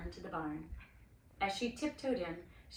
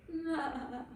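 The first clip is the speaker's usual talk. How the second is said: higher little lamb bleat; 7 semitones higher than usual. A narrator's reading. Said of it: "he bleated".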